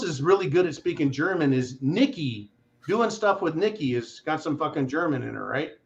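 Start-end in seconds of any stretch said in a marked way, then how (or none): none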